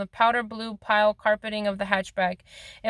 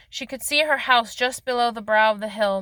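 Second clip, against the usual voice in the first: ordinary delivery, nothing marked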